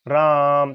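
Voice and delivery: राम with its आ held long; drawn-out vowel. Shown as a demonstration. Teacher repeating राम with the vowel held long for students. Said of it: "इतना लंबा खींच रहा हूं बीच में".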